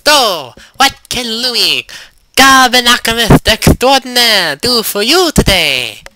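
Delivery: high, slow, loud goblin voice; squeaky goblin with a lisp. Acted. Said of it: "asked the unusual goblin".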